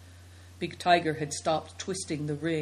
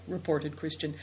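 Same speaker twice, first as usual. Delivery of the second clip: ordinary delivery, nothing marked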